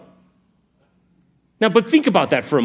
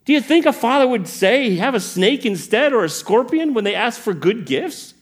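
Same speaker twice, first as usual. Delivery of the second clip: high-pitched, exasperated questioning; worked-up, incredulous challenge; incredulous Jesus; 7 semitones higher than usual. Acted. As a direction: pile up disbelief, shooting highest on the final word. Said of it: "That's what the tone is in this passage".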